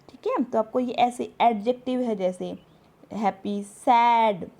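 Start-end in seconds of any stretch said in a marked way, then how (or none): 3.1-4.5 happy, सैड slow and louder, vowels stressed. Shown as a demonstration. Teacher giving stress examples to learners, slow and emphatic. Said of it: "vowel पे ही जो हमारा stress".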